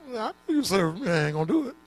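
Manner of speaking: God's refusal. Acted. God refusing again.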